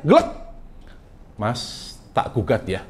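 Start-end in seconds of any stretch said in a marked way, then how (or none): 1.4-2.8 much softer, calm ultimatum; quiet wife. Retold or imitated. Javanese wife quietly telling her husband she will sue for divorce. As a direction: say it softly, almost offhand. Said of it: "Kata istrinya".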